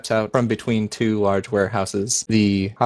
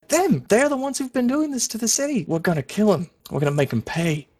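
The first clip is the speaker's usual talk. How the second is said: put-on much higher, fast and fierce girl's voice; furious street girl; 12 semitones higher, faster than usual. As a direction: spit out her hatred of the ship crew. Girl points at enemy ship.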